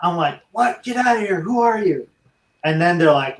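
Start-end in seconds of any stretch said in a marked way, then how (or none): 0.5-2.1 high-pitched startled mock yell; disbelieving yell at a stranger, retold. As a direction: replay yelling at the stranger, pitched up. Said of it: "I, I yelled".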